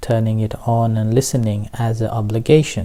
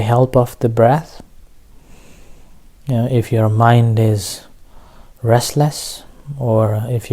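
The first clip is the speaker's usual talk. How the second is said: measured meditation teacher with long pauses; unhurried instructions, leaving room to breathe; guided meditation. Real, not acted. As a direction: guide listeners into relaxation, phrase by phrase.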